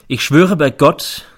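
slow, loud, measured; solemn oath. A narrator's reading. Witness swearing the oath in court, slow and solemn. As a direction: give each word weight.

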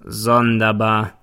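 slow, drawn-out doubting word; grinning irony. A narrator's reading. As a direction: stretch it out with a sly grin. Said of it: "grinst der Staatsanwalt". (süffisant gedehnt)

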